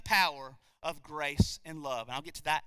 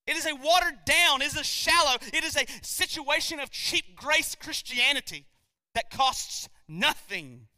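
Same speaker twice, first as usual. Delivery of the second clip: emphatic preaching; 7 semitones higher, 6 dB louder than usual. Real, not acted.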